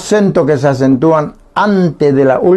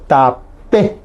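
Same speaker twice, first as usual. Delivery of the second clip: tapé, stressed on the final é; acento en la E; 2 semitones lower than usual. Shown as a demonstration. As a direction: say tapé in two syllables, landing firmly on the é. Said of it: "pronunciamos más fuerte en la E". (tapé, con la é final más fuerte)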